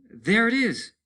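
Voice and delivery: high, loud cry of relief; excited shout. Acted. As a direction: call it out excitedly.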